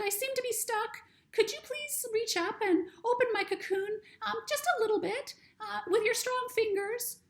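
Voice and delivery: a tiny high creature, frightened and stuck; butterfly voice. Acted. Butterfly speaks in story.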